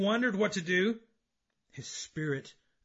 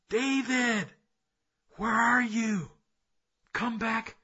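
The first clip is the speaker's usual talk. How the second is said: raised, higher calling voice; crying out; 7 semitones higher, 7 dB louder, slower than usual. Acted. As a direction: call out for someone who is lost. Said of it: "He cried out to him".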